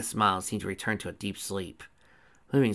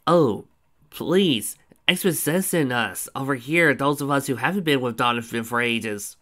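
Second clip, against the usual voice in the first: louder, higher, sarcastic complaint; demanding the explanation in a loud exasperated tone; 5 semitones higher, 7 dB louder than usual. Acted. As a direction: cut in with impatient sarcasm. Impatient interruption.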